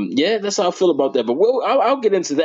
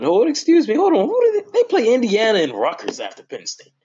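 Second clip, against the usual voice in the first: excited high break; 8 semitones higher than usual. Real, not acted. Predicting Michigan's season, voice jumps up high. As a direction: catch yourself mid-vision, squeaky and high.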